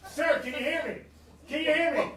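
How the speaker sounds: loud, high call to a patient; acted emergency urgency; urgent rescuer. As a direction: try to rouse the unconscious man. Rescuer checking on unconscious man.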